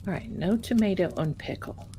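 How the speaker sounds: normal talking voice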